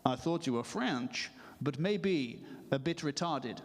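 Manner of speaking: put-on French accent, slowing to a drawl; deliberate French-accented football manager; measured French accent, stretching the final phrases; Arsene Wenger. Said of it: "that's my French accent".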